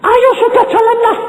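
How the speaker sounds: urging his wife up the cliff. Retold or imitated. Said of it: "aku ngono".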